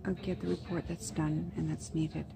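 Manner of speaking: near-whisper, keeping it down; hushed talk. Real, not acted. Waiting for the orthopedic surgeon, hushed whispering. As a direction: murmur so the waiting room can't hear.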